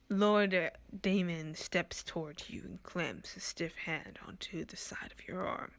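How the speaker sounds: ordinary talk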